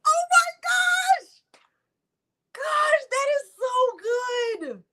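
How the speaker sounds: high-pitched shriek of delight; real excited squeal. Real, not acted. Host reacting to a giveaway win, thrilled squeal.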